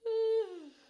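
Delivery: very high, loud sighing exhale; letting the stress go with a loud sigh; loud release sigh. Acted. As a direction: heave out a big loud sigh. Deep breath during tapping.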